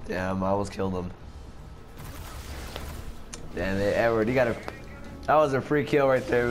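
usual speaking voice

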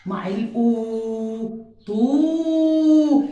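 طو with a long stretched u, slowly; stretched vowel. Shown as a demonstration. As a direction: draw out the u in طو. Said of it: "أنا بمد".

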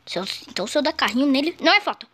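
ordinary delivery

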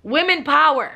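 playful slogan cry; mock rally cry. Real, not acted. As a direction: shout it like a rally chant. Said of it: "no, let me stop".

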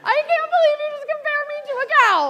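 high, loud, laughing indignation; mock outrage. Pregnant wife yelling at her husband, high and loud. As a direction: shriek it like an offended pregnant wife. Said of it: "very pregnant and a little emotional".

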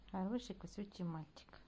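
soft whisper to the pet. Real, not acted. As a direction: whisper the praise gently. (ласковый шёпот)

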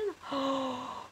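high, soft intake of breath in awe; sharp gasp. Real, not acted. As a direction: gasp as if you can't believe it. Gasping at a build's detail.

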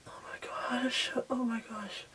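panicked whisper. Real, not acted. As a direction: breathe it out, barely voiced. Panicked makeup timer, whispering.

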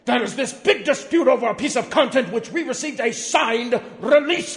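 loud, high indignant ranting; mock outrage. Reading the letter to the forum, a loud hammed-up outrage. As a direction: rant it like an offended executive.

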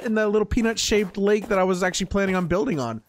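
normal talking voice

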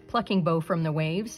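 usual speaking voice

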